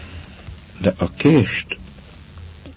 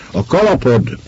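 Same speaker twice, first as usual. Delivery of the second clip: higher call after someone leaving; 8 semitones higher, 3 dB louder than usual. A narrator's reading. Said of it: "kiáltott utána az asszony".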